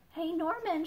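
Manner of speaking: high call across the garden. Acted. Nell calling Norman to her find, calling out. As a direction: call him over. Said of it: "she calls".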